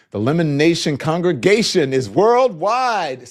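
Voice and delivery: loud, drawn-out congregation announcement; Verkündung an die Gemeinde. Acted. Reads chat out like preacher.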